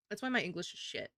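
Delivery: ordinary talk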